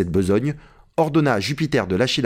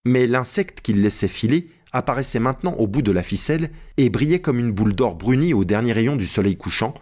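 ordinary delivery, nothing marked

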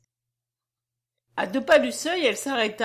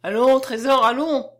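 put-on louder, firm command from an old man; ordering; 3 semitones higher, 8 dB louder than usual. A narrator's reading. Old man to his granddaughter, ordering her away. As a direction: order the child along. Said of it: "ordonna le vieillard".

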